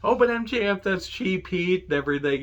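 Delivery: high, mocking voice of the online critics; mocking whiny critic. Host mimics fans calling MJF cheap heat, in a high mocking voice. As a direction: whine it like a smug Twitter complainer. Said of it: "he says".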